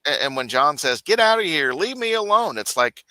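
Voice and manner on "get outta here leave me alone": high whiny get-out; mock shooing. John shooing Harvey, high mock whine. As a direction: brush him off.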